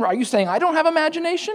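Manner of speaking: offended Squidward. Retold or imitated. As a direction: take it as a personal insult. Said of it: "But then".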